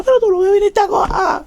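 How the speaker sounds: high, fast whiny reproach; mock complaint. Imita al chico reclamando. (reproche imitado, agudo y rápido)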